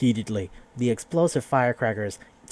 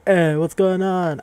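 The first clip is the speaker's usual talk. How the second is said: greaser greeting; 8 semitones higher, 8 dB louder, slower than usual. Retold or imitated. Clique leaders greeting the hero.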